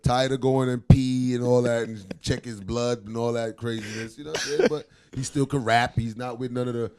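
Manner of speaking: playing a worn-out nineties hip-hop dude from the corner; a hint of an aging rapper from the nineties. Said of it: "Sound like that dude".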